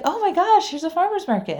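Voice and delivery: high-pitched, amazed discovery; retold gasp of excitement; excited surprise. Retold delighted find at market.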